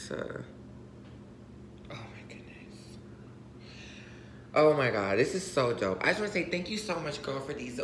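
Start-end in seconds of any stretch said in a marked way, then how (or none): 1.9-3.0 soft unvoiced whisper of joy. Real, not acted. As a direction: breathe it out thrilled. Whispering at gift.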